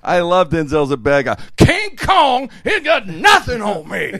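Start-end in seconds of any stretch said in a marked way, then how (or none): 1.6-4.1 loud slow bad-guy shout; Denzel shout. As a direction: bellow it like Denzel. Training Day quote.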